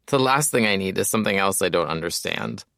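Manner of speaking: ordinary talk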